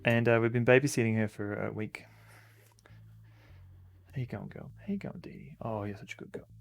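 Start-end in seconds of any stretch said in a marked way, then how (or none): 4.1-6.5 whispered, softer cooing to the dog; soft pet talk. Real, not acted. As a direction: hush and coo over the dog.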